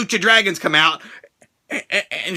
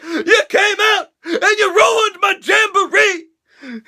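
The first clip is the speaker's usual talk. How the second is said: whiny Big E; 11 semitones higher, slower than usual. Retold or imitated. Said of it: "Big E is like".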